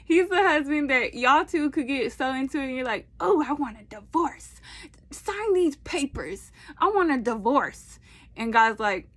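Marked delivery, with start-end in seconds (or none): 3.2-8.0 higher, hammed-up demand for a divorce; mock outraged spouse; mock dramatic spouse. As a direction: play the huffy partner asking for divorce papers. Voicing angry spouse demanding divorce.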